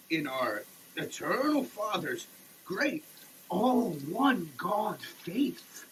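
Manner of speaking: ordinary talk, nothing marked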